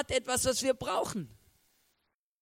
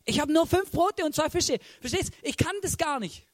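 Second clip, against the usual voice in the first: higher, louder helpless protest; acted-out, raised-pitch objection; protesting first reaction; 6 semitones higher, 4 dB louder than usual. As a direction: plead that it cannot be done. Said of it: "meine erste Reaktion ist".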